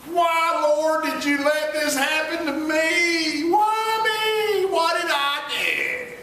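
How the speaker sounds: high shouting lament; anguished cry. Retold or imitated. A believer in crisis asking God why, anguished.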